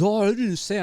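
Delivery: imitating a high, innocent denial; kid denying it. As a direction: play the kid acting like he said nothing. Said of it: "And he was".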